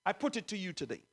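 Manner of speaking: ordinary talk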